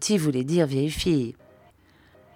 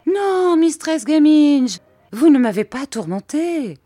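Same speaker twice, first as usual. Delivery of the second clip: high, loud pleading protest; urgent high protest; troubled cry; 11 semitones higher, 6 dB louder than usual. A narrator's reading. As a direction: cry out to comfort her. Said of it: "je m'écriai dans mon trouble".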